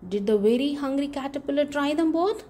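ordinary delivery

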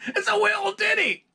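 high, loud falsetto Scottish impression, "little ditty" squeezed to "wee-l dinny"; impression of a Scottish singer. Mimicking [name] mimicking the Outfield singer, shrill Scottish falsetto. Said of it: "impersonating the singer from the Outfield".

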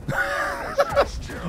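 high-pitched burst of laughter; helpless high cackle; laughing. Real, not acted. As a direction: crack up in a high squeal. Bursts out laughing mid-game.

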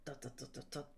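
ordinary delivery

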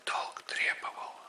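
ordinary delivery, nothing marked

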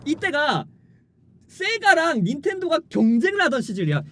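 ordinary talk, nothing marked

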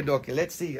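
ordinary delivery

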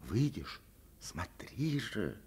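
soft, slow pressing question. Acted. Girl silently urging the boy, soft and slow. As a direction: coax him quietly. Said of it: "допытывается без слов".

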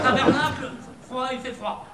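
high, loud mock Québécois; boisterous put-on Québécois accent. Shown as a demonstration. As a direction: join in with a laughing Québécois imitation. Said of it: "c'est l'accent québécois".

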